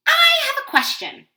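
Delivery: very high, loud little voice; high cartoon chick announcing itself; duckling voice. A narrator's reading. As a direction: pipe up eagerly as a little duckling. Voicing the duckling asking Pigeon.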